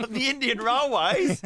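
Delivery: high, slow laughing question; laughing disbelief. Real, not acted. Host laughing at the railway spokesman's claim.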